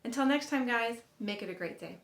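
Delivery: ordinary talk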